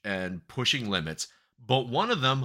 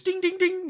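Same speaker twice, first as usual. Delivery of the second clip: high sing-song ding ding; slot machine dings. Retold or imitated. Describing the jackpot scene, high imitated machine dings. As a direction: chime the dings like a jackpot bell.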